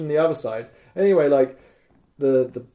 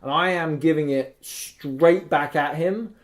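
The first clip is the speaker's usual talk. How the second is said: usual speaking voice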